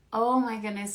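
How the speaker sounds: ordinary talk, nothing marked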